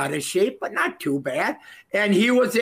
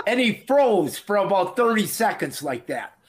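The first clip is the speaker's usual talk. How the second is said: ordinary talk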